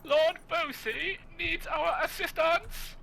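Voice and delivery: rally the troops in a silly high voice; squeaky heroic call. Pretend. Mock heroic falsetto.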